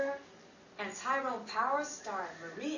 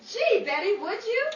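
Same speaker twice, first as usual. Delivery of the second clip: eager surprise; 10 semitones higher, 9 dB louder than usual. Acted. Grateful surprise at Betty's offer.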